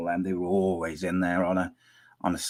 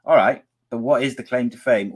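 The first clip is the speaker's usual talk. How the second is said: ordinary talk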